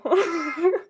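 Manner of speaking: short laugh. Real, not acted. Laughing at own joke.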